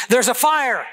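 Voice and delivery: retold scream, pitched high without real volume; high mock yell of alarm. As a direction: imitate a panicked attendant's cry, held back. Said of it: "and he screams".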